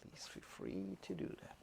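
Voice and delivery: unvoiced gentle whisper. Shown as a demonstration. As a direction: breathe the words out. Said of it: "repeat then whisper".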